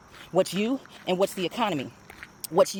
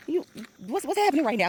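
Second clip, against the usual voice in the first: much higher, voiced friend asking; worried friend; 12 semitones higher than usual. Friend sees her laughing, asks in a high voice. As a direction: squeal up high like a startled friend. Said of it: "And she was like".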